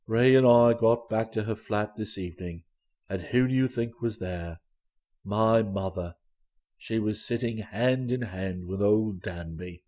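low, soft, dreamy voice; subdued, wondering man's voice; dazed young man in love. A narrator's reading. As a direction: tell the news as if dreaming. Plays Gussie, dreamy happy young man.

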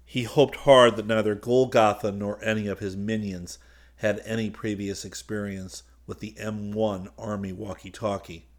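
usual speaking voice

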